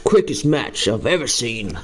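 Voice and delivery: "quickest match I've ever seen" in a lightly put-on Irish lilt; half-hearted Irish commentator, second try; mock Irish accent. Streamer redoing the line in his best Irish accent. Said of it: "I can only really say Conor".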